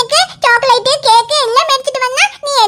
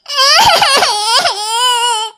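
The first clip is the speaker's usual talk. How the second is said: wailing cry. Acted. Boy bursts into crying.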